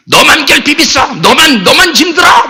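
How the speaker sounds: 목소리를 높여 쏘아붙이는 말싸움 흉내; 상대에게 따지듯 높게; 싸우는 말투. Acted. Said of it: "이제 뭐 싸움이 얘기죠". (말다툼하듯 따지는 투)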